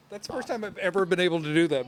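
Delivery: ordinary talk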